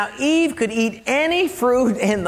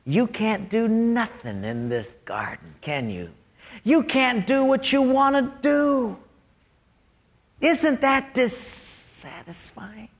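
slow, insinuating devil, softly stretching the words; tempting devil; 4 dB quieter, slower than usual. Acted. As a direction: coax like a sly tempter, drawing out key words. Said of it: "And the devil says".

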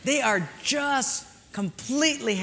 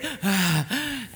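big puffing breaths before a dive; imitated hyperventilation. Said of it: "we do a hyperventilation thing".

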